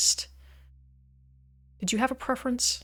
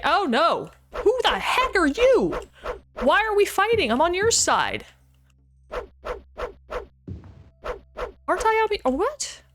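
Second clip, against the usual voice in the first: much higher, louder and startled; real alarmed surprise; 11 semitones higher, 3 dB louder than usual. Real, not acted. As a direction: yelp it as you're suddenly attacked. Attacked by allies in game.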